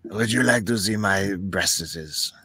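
put-on slow, soft gravelly old lady voice; husky smoker's drawl, slowed down.

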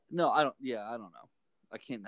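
ordinary delivery

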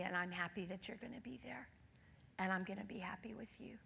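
soft tender whisper to her father. Retold or imitated. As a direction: hush it gently, like a bedside promise. Tender words to dying father.

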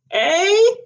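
sharp, high, loud "Eh?"; deaf old man's shout. A narrator's reading. Drowsy old magistrate shouts at the witness, loud. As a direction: bark it out like a half-deaf old judge. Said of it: "shouted Sir Alan Darsdale".

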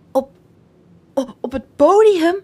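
nervous stutter on every start; trembling. Acted. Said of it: "al bibberend bij de gedachte".

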